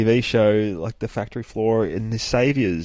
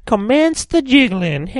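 high, loud, slow cartoon voice; Mister Jigglebottom; 14 semitones higher, 8 dB louder, slower than usual. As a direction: squeal it out like a cartoon oddball. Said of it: "Mister Jigglebottom".

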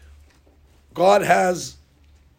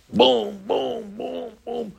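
1 anvil banging; 5 semitones higher, 5 dB quieter, much slower than usual. Retold or imitated.

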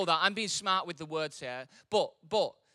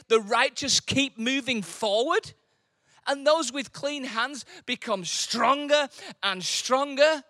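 loud, slow, high proclamation; preaching at full force; 9 semitones higher, 12 dB louder, slower than usual. Acted. Preacher proclaims the verse loudly to the congregation. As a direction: thunder the verse to the church.

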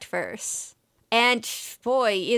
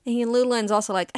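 usual speaking voice

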